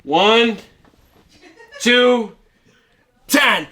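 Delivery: gangster countdown. Retold or imitated.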